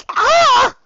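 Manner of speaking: very high, loud pained scream. A narrator's reading. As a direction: scream through a contraction. Labor scream.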